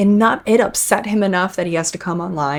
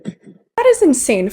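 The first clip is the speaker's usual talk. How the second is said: higher, slow, stunned disbelief; shocked; 8 semitones higher, slower than usual. Real, not acted. Reactor stunned by Jacob's complaint, drawn-out disbelief. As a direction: let the shock stretch the words out.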